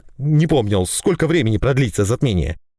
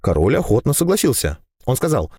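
ordinary delivery, nothing marked

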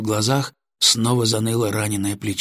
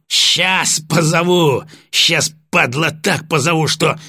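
high, drawn-out furious threat; angry threat; 7 semitones higher, 3 dB louder, slower than usual. A narrator's reading. Sergeant kicking prisoner who wants a senior, furious. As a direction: rage slowly, stretching each threat.

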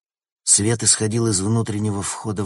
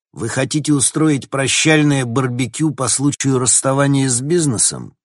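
loud, higher, sarcastic jibe; loud mocking; 7 semitones higher, 7 dB louder than usual. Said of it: "громко сказал Логан". (громко, с насмешкой)

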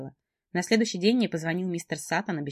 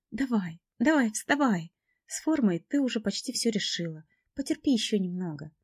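high, coaxing; encouraging friend; 5 semitones higher than usual. A narrator's reading. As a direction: urge her up gently. Friend urges her to get up.

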